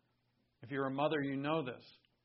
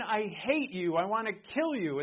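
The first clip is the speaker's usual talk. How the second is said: higher-pitched, mildly acted declaration of hate; earnest hatred; 5 semitones higher, 4 dB louder than usual. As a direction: act out a loved one who really means it. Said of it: "and they really mean it".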